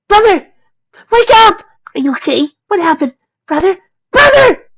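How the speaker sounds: high, loud panicked calling; desperate cry. A narrator's reading. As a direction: shriek for his brother to wake. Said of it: "he cried out desperately".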